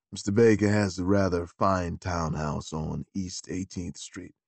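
a low, deferential gentleman's gentleman; valet Beauregard. Acted.

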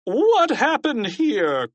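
high, slow, drawn-out principal's cry; distraught cry. A narrator's reading. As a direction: cry out in shock, stretching the words. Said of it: "cried Mister Krupp".

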